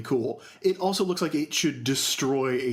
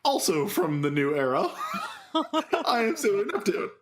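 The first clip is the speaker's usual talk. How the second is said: laughing; 6 semitones higher than usual. Retold or imitated. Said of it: "she goes".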